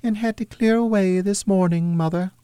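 high falsetto daughter voice; girl replying. A narrator's reading. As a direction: explain yourself as a young girl, pitched high. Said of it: "replied the girl".

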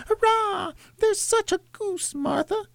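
put-on very high, gleeful child falsetto; young children crying out. A narrator's reading. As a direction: cheer like little children in a tiny high voice. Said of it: "cried the two young Cratchits".

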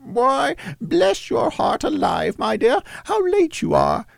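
high falsetto fond mother; Cratchit's wife. A narrator's reading. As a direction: greet your child in a put-on motherly falsetto. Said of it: "said Missus Cratchit".

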